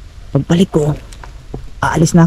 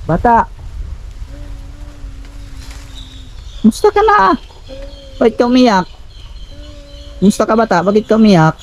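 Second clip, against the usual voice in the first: raised, questioning call; 12 semitones higher, 4 dB quieter than usual. Real, not acted. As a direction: call out across a distance, voice raised high. Calls out to child.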